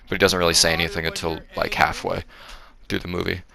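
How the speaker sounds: ordinary delivery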